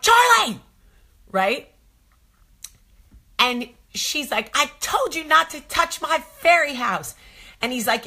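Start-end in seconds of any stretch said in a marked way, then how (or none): 0.0-0.6 sharp high yell across the field; mom's alarmed shout; shouting her name. Retold or imitated. Mother yelling at daughter. 4.5-7.1 put-on a high, indignant little girl voice; little girl scolding. Daughter scolding boy, voiced.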